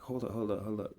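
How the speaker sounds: hushed quick aside. Retold or imitated. Speaker pauses his sparring partner, acted hushed aside. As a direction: softly put someone on hold.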